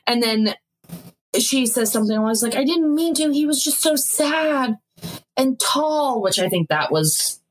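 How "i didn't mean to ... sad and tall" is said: higher-pitched pleading excuse; apologetic girlfriend. Retold or imitated. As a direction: lightly imitate her sorry explaining. Acted girlfriend apologizing and excusing herself.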